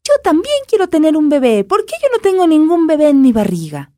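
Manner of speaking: high whiny doll; tiny doll voice. A narrator's reading. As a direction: whine like the smallest doll. Smallest doll complains.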